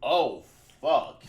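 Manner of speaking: loud, high retold shock; shocked outburst. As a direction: reenact the moment of seeing the injury. Acts out seeing knife in leg.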